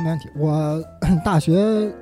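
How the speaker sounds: usual speaking voice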